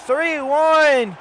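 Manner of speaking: excited goal call. Acted. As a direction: shout the bicycle-kick goal.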